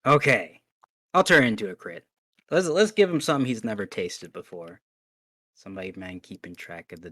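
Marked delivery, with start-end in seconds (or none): 0.0-4.8 loud triumphant declaration; excited. Real, not acted. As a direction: relish the big hit. Player declaring a crit.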